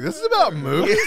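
high, laughing, incredulous rise on movies; a listener confused by the podcast name; baffled question. Retold or imitated. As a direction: sound completely puzzled by the title. Voicing a puzzled newcomer.

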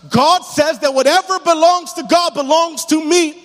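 loud, high, ringing sermon delivery; full-force preaching. Acted. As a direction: preach it out to the whole church, rising hard.

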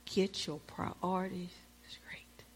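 slow, soft whisper; whispered voice of God. Retold or imitated. The Lord speaking to the preacher, in a slow whisper. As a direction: breathe the command slowly. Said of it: "And the Lord said".

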